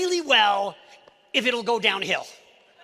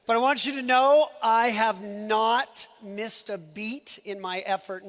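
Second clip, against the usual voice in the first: normal talking voice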